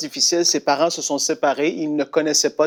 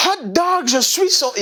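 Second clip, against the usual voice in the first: much higher, delighted; happy cry; 12 semitones higher than usual. Retold or imitated. Boy at breakfast table crying out his joy, higher. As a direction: sing it out at breakfast. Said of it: "Et il dit".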